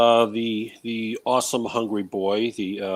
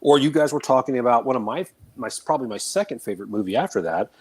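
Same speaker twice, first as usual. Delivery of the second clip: ordinary delivery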